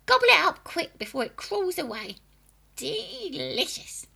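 put-on high, loud cackling old woman; gleeful old hag relishing it; witchy grandma voice. A narrator's reading. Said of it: "Grandma said".

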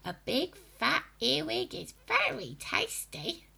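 high, breathy hag relishing it; witchy grandma voice. A narrator's reading. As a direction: whisper it greedily like a witch. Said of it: "Grandma said, licking her lips".

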